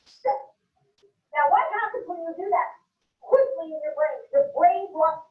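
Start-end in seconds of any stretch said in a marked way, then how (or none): 0.1-0.4 "Shit" as one short, punched word; emphatic swearing. Shown as a demonstration. Reacting to fingers slammed in a car door, emphatic. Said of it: "you say it with emphasis".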